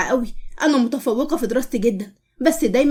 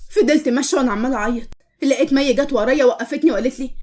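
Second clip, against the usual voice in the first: ordinary delivery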